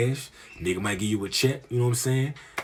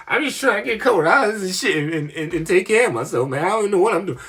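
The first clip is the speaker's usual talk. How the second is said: high, louder desperate whine; whiny high voice; 9 semitones higher, 5 dB louder than usual. Retold or imitated. Himself in construction outfit, whining.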